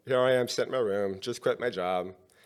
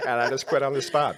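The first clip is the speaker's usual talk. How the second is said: laughing; 5 semitones higher, 8 dB louder than usual. Real, not acted. Laughing while retelling quitting.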